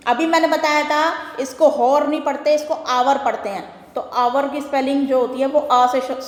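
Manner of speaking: usual speaking voice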